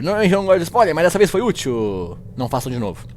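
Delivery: usual speaking voice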